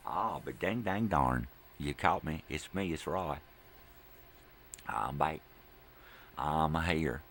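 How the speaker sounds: loud Southern character voice; Southern accent. Acted. Roy caught sneaking back in, loud Southern accent. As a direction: announce yourself in a hammed-up Southern accent. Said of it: "Where's that southern accent?".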